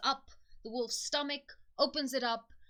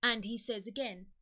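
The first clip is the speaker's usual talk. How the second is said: usual speaking voice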